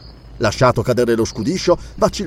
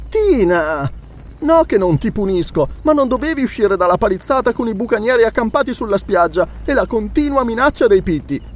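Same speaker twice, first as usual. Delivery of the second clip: moaning; 8 semitones higher than usual. A narrator's reading. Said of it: "gemette Belesa".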